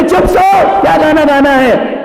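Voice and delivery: high, loud exasperated yell; angry father shout. Acted. Father shouting at son who wants to sing at midnight. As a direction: snap at the kid to shut up.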